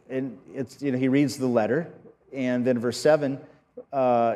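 ordinary delivery

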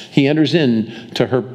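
normal talking voice

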